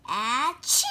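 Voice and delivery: mock sneeze with a whimper; acted sneeze. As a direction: sneeze with a little cry in it.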